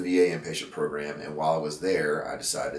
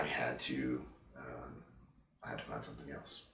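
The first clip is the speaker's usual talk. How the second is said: softer, choked words; quietly overcome, fading away; emotional; 2 semitones lower, 10 dB quieter than usual. Real, not acted. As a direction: let the emotion pull the voice down. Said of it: "I'm getting emotional thinking about it".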